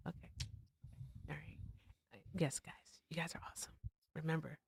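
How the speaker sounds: quick unvoiced whisper. Real, not acted. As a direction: hush it, fast and breathy. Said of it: "so now I'm whispering".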